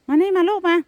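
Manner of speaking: high, loud call to a pet; calling the cat. Real, not acted. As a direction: call the cat over the wind. Calling her cat in storm.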